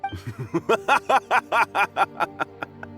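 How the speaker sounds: long, high derisive laugh; mocking laugh. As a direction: laugh the meddler to scorn. Bully mocks the disciple.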